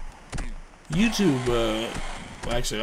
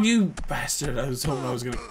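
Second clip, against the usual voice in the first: ordinary talk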